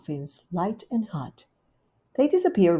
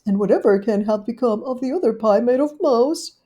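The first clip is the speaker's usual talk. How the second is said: higher, louder dog-lady voice; fretful little dog waiting; Duchess's voice; 4 semitones higher, 7 dB louder than usual. Acted. Duchess waiting in the lane, higher fretful voice.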